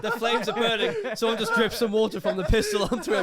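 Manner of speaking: cracking up while describing; laughing. Real, not acted. Laughing, building absurd scene.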